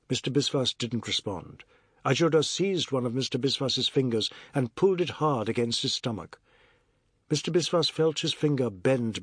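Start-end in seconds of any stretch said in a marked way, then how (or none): none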